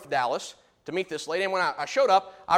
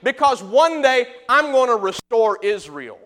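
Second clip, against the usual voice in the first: slow, loud and high, God telling Israel to stop weeping; voice of God consoling; 6 semitones higher, 8 dB louder, slower than usual. Retold or imitated. God promising Israel restoration, slow and booming. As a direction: boom it out slowly, a comforting divine promise.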